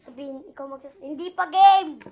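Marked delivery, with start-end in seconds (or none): 1.0-2.0 loud shout not ready. Real, not acted. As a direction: yell across the room.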